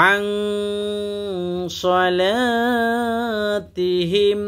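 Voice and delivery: slow, drawn-out chanted recitation; Quran recitation. Shown as a demonstration. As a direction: recite with long melodic stretches. Recites the verse. (ধীর সুরেলা কুরআন তিলাওয়াত)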